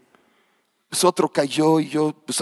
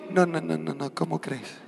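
mock refusal; 4 semitones higher, 6 dB quieter than usual. Mocking humble refusal.

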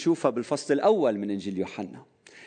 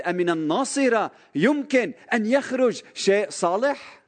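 high, scornful mock question; bigoted sneer; 7 semitones higher than usual. The zealous Nathanael scoffs at Nazareth, mock scornful. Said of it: "كان نثنائيل هيدا الشخص المتعصب".

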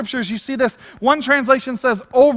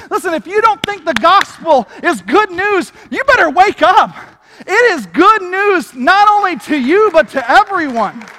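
fervent preaching; 5 semitones higher, 4 dB louder than usual. Acted. Preaching at full force.

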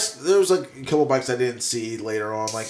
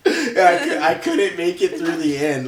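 laughing; 4 semitones higher, 3 dB louder than usual. Real, not acted. Laughing fit recalling podcast.

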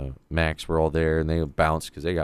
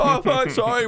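high startled yelp of sorry; startled apology; 4 dB louder than usual. Retold or imitated. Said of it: "we're like".

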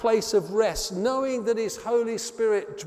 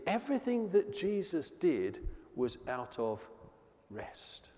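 whispered ending; 8 dB quieter than usual. Shown as a demonstration. Repeated key line, rest whispered.

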